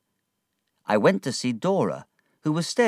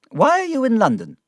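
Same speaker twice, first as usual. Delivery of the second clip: put-on high, loud and quick old lady's question; aunt startled to find Agnes in London, high and quick; surprised old aunt; 7 dB louder, faster than usual. A narrator's reading. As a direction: blurt it out in surprise.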